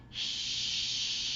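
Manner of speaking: long hushing shh; librarian shushing whisper. Shown as a demonstration. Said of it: "make an S H sound like a cranky librarian".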